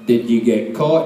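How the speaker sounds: low-pitched dad voice; deadpan low fatherly question; retold father. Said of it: "and then he went".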